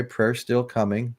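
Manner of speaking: ordinary talk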